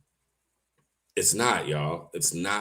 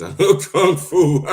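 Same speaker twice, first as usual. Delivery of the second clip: laughing; 9 semitones higher, 6 dB louder, slower than usual. Real, not acted. Reading viewer's joke, amused.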